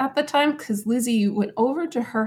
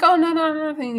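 high, hurried mock denial; brushing him off, hiding something; lightly voiced flustered denial, up high; evasive wife; 8 semitones higher, 4 dB louder, faster than usual. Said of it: "she's, like, trying to hide it".

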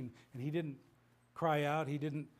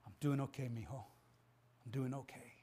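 softer, hushed and tender, fading to a whisper; gentle reassurance; 4 semitones lower, 8 dB quieter than usual. Retold or imitated. Dying father gently reassuring his son, soft tender whisper. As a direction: reassure your son through the pain. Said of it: "with a smile on his face".

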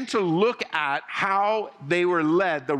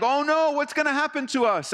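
higher, a mock panicked cry; 8 semitones higher than usual. Preacher imitating the frightened Israelites. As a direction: play it as desperate panic. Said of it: "I think they're just desperate".

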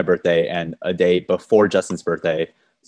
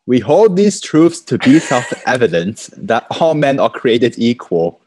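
put-on slow, high, loud old-timey British accent; colonial accent; 5 semitones higher, 5 dB louder, slower than usual. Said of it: "It's getting a bit overseas".

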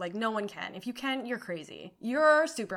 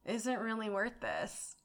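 quiet inner thought; 2 semitones lower, 9 dB quieter than usual. Retold or imitated. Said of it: "that's when I was like".

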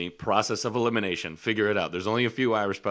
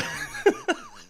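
squealing high laugh; real amused laugh; high burst of laughter; 3 dB louder than usual. Real, not acted. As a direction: cackle in disbelief. Laughing at her.

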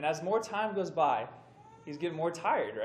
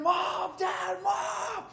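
high, frantic mock scream for his parents; retold screaming; 4 dB louder, much slower than usual. Hurt boy runs home screaming for his parents, high mock scream. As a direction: imitate a kid screaming for mom and dad. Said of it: "he's screaming".

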